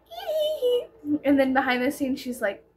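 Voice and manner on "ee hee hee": high mock giggle; giggly girl. Imitating the girl's cute on-air persona, giggling high. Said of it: "It's like".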